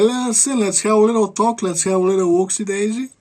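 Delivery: hammed-up high agent voice, baby-talk menace; mock FBI agent. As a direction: squeak out a fake-sweet cop at the door. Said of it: "and the F B I is like".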